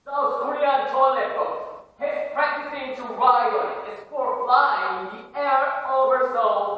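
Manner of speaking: high, loud, slow and wide-eyed; excited tall tale. Acted. Student telling the counselor a wild story, loud excited awe. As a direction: sell the crazy story with awe.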